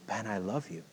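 soft, hushed gentle voice; voice of Jesus, softly. Retold or imitated. Preacher recalling Jesus speaking to him, very soft. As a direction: let it land quietly and tenderly. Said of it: "this clear voice of Jesus".

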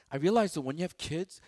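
ordinary delivery

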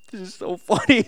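talking through laughter; amused, loud, breaking up with laughter; laughing. Real, not acted. Laughing at his own story.

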